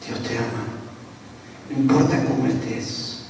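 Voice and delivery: very soft, low and tender; almost hushed, consoling someone held close; tender comfort. Retold or imitated. Pastor embraces the weeping robber, tender comfort.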